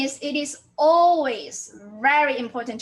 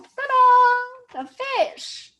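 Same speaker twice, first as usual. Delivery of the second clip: high, loud, drawn-out ta-da; playful ta-da reveal; 10 semitones higher, 7 dB louder, much slower than usual. Real, not acted. Teacher unveiling an example to students, playful fanfare. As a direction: sing out a little fanfare.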